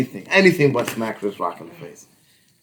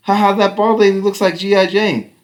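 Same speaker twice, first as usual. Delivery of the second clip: higher, louder mock taunt; mocking kid; 8 semitones higher, 5 dB louder than usual. Son laughing at bald lady, mocking. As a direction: tease like a cheeky kid. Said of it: "he's just like".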